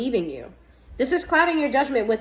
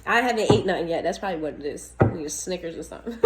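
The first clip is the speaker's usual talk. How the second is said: normal talking voice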